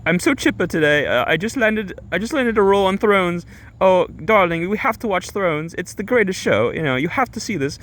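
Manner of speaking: high, chipper mock-British woman; badly faked British accent. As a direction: gush in a high, put-on British voice. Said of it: "all chipper".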